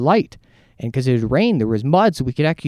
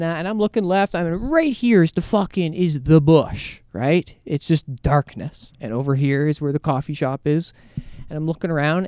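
normal talking voice

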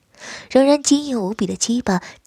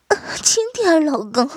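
high, breathy plea; 8 semitones higher than usual. Acted. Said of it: "轻声说道".

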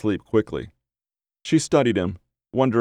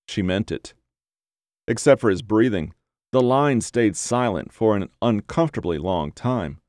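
ordinary delivery, nothing marked

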